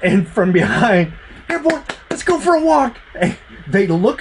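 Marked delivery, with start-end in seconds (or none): 1.5-3.0 high, lightly imitated call to the dogs; imitating the dog call. Game master retelling the call from behind, high.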